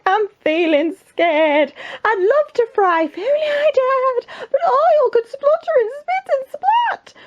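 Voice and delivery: very high, drawn-out wail; scared cook. Acted. Cook afraid of fishing for the king, high panicky wail. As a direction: squeal it like a terrified storybook wimp.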